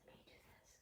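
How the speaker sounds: very soft whisper; barely audible whisper; whispered aside. Real, not acted. Quick aside between sung lines.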